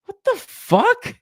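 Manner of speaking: slow, high, drawn-out what the; hammed-up disbelief at the villain; stunned disbelief. Reacting to the show's weak villain, stunned, stretched-out disbelief. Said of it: "It was like".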